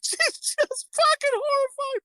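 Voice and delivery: high voice, words breaking up with laughing; helpless laughter. Real, not acted. Retelling the horrified woman's scene, cracking up. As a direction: try to talk through a giggling fit.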